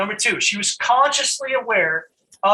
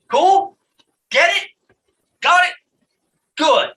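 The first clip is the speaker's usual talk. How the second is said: much higher and louder, punchy rising questions; excited check-in; 9 semitones higher, 6 dB louder than usual. Real, not acted. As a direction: fire them up, one quick question at a time. Pumping up the audience.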